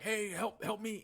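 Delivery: high, pleading cry from a trapped man; desperate call for help. Retold or imitated. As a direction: call out like you're stuck in a trench. Trapped guy calling for help.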